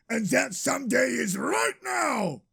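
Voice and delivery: high, rousing proclamation; triumphant announcement. A narrator's reading. Narrator announces the sequel to the story, high and rousing. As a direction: announce it with sudden excitement.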